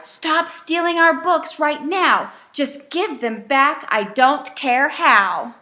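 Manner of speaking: loud, high shout at the thief. Acted. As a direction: yell it out the window. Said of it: "shouted to the thing outside".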